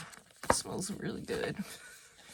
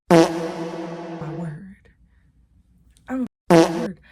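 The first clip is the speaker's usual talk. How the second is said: loud exclamation; 13 dB louder, much slower than usual. Real, not acted. Garbled shouted exclamation.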